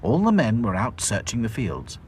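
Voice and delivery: ordinary delivery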